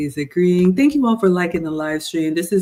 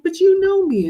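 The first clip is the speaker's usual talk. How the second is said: pleading; 4 dB louder than usual. Retold or imitated. Retelling plea to her partner.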